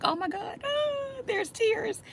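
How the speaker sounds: high, teary wail; mock crying. Child overwhelmed by father's look, imitated high teary wail. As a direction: imitate a child breaking into tears, pitch jumping up. Retelling herself as emotional child.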